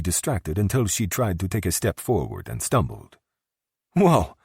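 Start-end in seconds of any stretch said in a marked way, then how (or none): 3.9-4.4 high, loud startled whoa; tipsy whoa. A narrator's reading. Startled tipsy whoa, high and loud. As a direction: drunk Valentina stumbles on a step.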